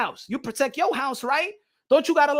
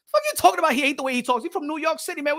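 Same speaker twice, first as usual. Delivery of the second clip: ordinary delivery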